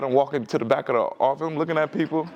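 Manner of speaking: ordinary talk, nothing marked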